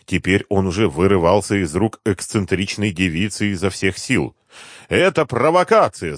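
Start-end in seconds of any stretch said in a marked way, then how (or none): 4.9-6.2 high, indignant outcry; крик. A narrator's reading. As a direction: cry out in protest, pitched well up. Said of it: "закричал он". (возмущённый высокий крик)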